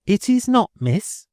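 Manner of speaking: high, sarcastic landlady jab. A narrator's reading. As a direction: sneer with a sarcastic smile. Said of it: "with a sarcastic smile".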